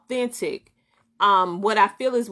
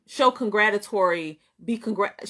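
normal talking voice

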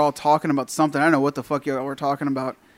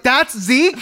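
high, rising disbelief; incredulous question; 9 semitones higher, 4 dB louder than usual. Retold or imitated. Friend learning the stranger is Zeke, high incredulous question. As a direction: ask it pitched right up, astonished.

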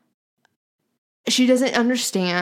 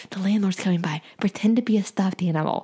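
hushed conspiratorial whisper. Pretend. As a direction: whisper the warning to the dog. Telling dog to hide.

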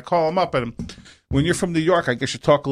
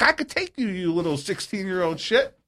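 high, soft mock threat; pissed off; 4 semitones higher, 6 dB quieter than usual. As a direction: retell a petty challenge to a teenager. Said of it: "Well, I was pissed".

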